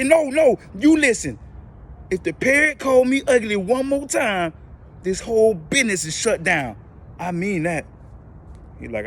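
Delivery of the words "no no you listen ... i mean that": high, loud raging threat; furious tirade. Retold or imitated. Angry lady threatening the manager about the parrot. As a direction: let rip at the manager. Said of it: "The lady was mad".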